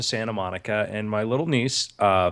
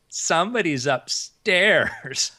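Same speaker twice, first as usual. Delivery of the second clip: ordinary delivery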